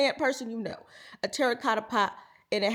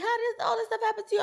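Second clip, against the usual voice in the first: a hint of mocking husband, fast; 11 semitones higher, faster than usual. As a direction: lightly mimic the husband. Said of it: "he's like".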